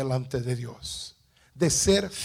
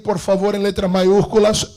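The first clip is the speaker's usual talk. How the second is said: usual speaking voice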